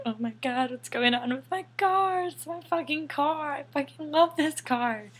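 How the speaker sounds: retelling the panic at the gas station; panicked wail. Retold or imitated. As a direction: freak out over the smoking car. Panic as car smokes.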